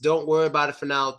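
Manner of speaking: ordinary talk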